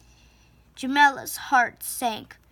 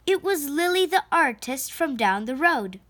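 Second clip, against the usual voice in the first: ordinary delivery, nothing marked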